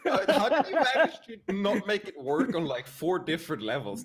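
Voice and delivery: high, loud laughing outrage; laughing disbelief. Real, not acted. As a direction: laugh through the mock indignation.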